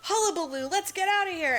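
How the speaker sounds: hammed-up scramble to leave; mock commotion. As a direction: call it out like a comic panic. Everyone panics, acted out.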